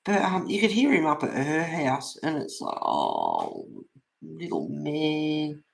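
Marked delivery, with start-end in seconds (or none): 2.8-5.6 slow, soft pet voice; slow, tender pet talk; tender comfort to cat. Retold or imitated. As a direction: soothe the little cat gently.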